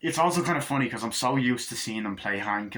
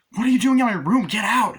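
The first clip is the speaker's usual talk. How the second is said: put-on high, loud shrieking girl; mock shriek; 12 semitones higher, 8 dB louder than usual. Blonde girl shrieking at intruders in her room. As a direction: shriek it like an outraged teen.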